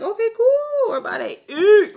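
high, loud, giddy cheer; squealed retold excitement; excited about food. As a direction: celebrate the food arriving. Hungry, sees the food arrive.

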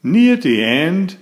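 usual speaking voice

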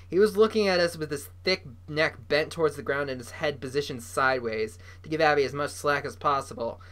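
usual speaking voice

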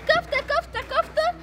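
high teasing chant; mocking sister. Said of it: "كنتي عمالة تضحكي عليا تقولي". (تقليد شماتة الأخت بصوت رفيع)

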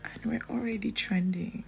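soft hushed whisper. Real, not acted. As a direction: breathe it out unvoiced. Whispering just after waking.

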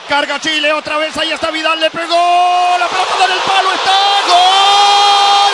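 racing commentary building to a roar; play-by-play. Real, not acted. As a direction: call the attack, explode on gol. Live play-by-play to goal.

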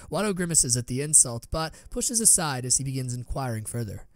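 plain reading voice

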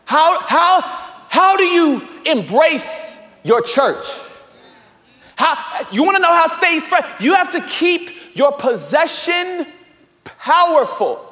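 high, loud preacher's cry; full-force preaching. Acted. Pastor crying out to the congregation, loud and high. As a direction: build to a shout from the pulpit.

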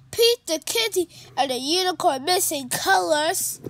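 bright, loud announcer welcome; read-aloud intro. A narrator's reading. Host welcoming kids to a read-along, upbeat and loud. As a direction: greet young readers with big energy.

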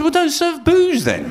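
high, slow gloating comeback; squeaky smug punchline; triumphant retort. Retold or imitated. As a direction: win the argument with the barman. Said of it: "So I said".

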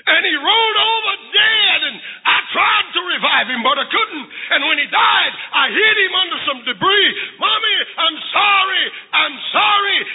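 high, urgent, crying-out confession; tearful confession. Acted. Boy confessing to mother about the duck, tearful. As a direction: pour it out in an acted, high-pitched plea. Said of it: "he was crying so now".

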